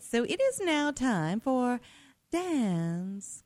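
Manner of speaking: segment announcer. Pretend. Host introduces Dan's commentary segment, drawn-out announcer call. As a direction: stretch out the segment title like an announcer.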